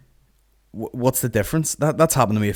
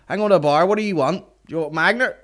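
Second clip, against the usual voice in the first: higher-pitched mock punter taking drink orders; raised, oblivious drink-order voice; 8 semitones higher than usual. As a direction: mimic someone calling out a bar order mid-show. Imitating drunk audience at bar.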